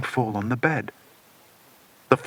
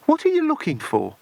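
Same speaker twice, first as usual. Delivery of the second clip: much higher woman's voice; Ingrid's voice. A narrator's reading. Ingrid asking Bill at the desk, high puzzled voice.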